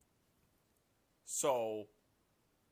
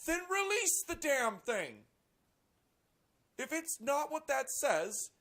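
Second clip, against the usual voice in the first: loud, high, slow frustrated yell; fed-up shout; exasperated yell; 14 semitones higher, 7 dB louder, slower than usual. Real, not acted. Exasperated outburst.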